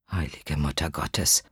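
low, stunned murmur; hushed prayer. Acted. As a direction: breathe it out in horror. Horrified inner prayer, very low.

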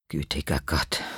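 low, breathless murmur; hushed prayer. Acted. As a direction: breathe it out in dread. Inner shocked prayer, very low.

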